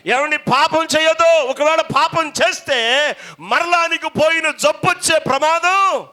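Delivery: high, loud pulpit shouting; fiery preaching. Acted. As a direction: preach it with full force. Preaching at full force.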